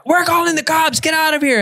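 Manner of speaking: high-pitched mom ordering him off the property; mom threatening. Retold or imitated. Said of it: "the mom comes out and she's like".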